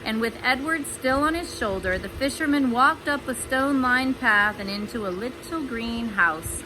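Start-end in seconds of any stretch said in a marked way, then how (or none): none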